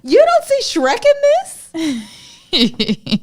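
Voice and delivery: stretched high squeal; high drawn-out. Real, not acted. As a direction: pull it out high and slow. Playful high drawn-out question.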